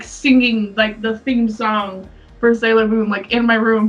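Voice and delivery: normal talking voice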